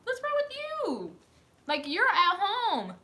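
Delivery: high, incredulous mock question; a high, incredulous voice asking what's wrong; incredulous quote. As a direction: play the baffled coworker-watcher. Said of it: "I'm like".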